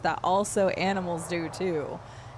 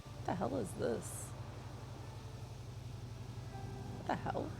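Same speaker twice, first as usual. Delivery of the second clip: hushed confusion; 5 semitones higher, 10 dB quieter than usual. Real, not acted. Startled by pop-up, hushed.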